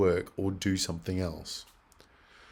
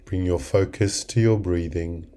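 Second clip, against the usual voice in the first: guided meditation; 3 semitones lower than usual. Acted.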